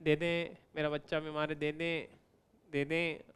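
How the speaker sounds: soft, low beggar's plea. Acted. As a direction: beg quietly, as if asking for alms. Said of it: "The beggar is like". (भिखारी जैसी धीमी, दबी याचना)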